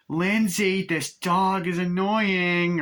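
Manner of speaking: put-on girl whining at the kid, high and drawn out. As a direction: whine at her like a bored teen babysitter. Voicing Annie calling out.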